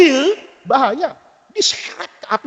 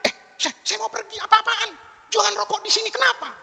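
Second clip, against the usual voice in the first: impatient sheikh; 10 semitones higher, 3 dB quieter than usual. Retold or imitated.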